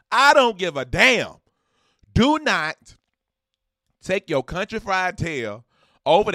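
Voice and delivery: loud, high exasperated snap; loud mock-angry rant; fed-up outburst. Real, not acted. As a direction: shut down the bootleg talk hard. Scolding bootleggers.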